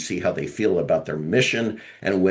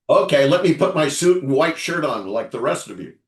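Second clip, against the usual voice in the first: higher, louder teasing impression; playful mock voice; 7 semitones higher, 6 dB louder than usual. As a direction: tease him in his voice.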